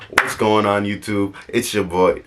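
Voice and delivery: loud, high, hyped-up channel greeting; tongue-in-cheek loud hype intro; mock hype YouTuber intro. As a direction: ham up the big YouTuber hello as a joke. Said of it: "Nah, I'm just kidding".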